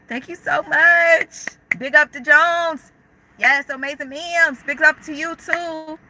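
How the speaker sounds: thanking supportive viewers on a live stream, effusive; grateful gush. Real, not acted. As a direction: stretch out each word with big gratitude.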